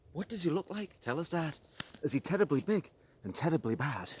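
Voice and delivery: put-on excited kid pestering dad; curious child.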